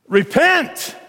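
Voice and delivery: high-pitched preacher's command; emphatic proclamation. Retold or imitated. Preacher quotes Peter's answer, high and emphatic.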